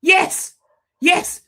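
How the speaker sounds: loud, high triumphant yes; excited yelling. Real, not acted. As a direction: cheer it out loud. Cheering at the screen.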